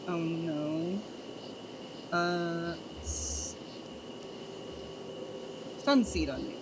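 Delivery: ordinary delivery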